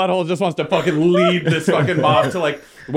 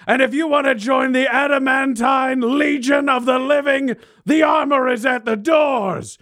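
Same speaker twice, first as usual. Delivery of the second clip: slow, high rallying yell; yelled war speech; 10 semitones higher, slower than usual. Acted. Butthole rallying the crowd to war, slow yell. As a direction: declaim each line long, building to war. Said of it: "And Butthole just yell".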